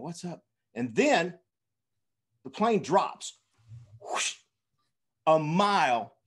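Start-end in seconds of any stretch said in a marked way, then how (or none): 0.0-0.4 mock hushed question, much softer; retold whisper. Nervous passengers asking each other mid-flight, mock whispering. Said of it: "we're kinda whispering". 4.0-4.3 airy falling-plane whoosh; imitated whoosh. Storyteller imitating the plane's plunge, whooshing noise.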